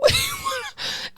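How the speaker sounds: a short, high burst of laughter; real laugh. Real, not acted. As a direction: laugh it off at your own line. Laughs at her own outburst.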